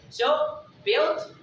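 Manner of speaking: normal talking voice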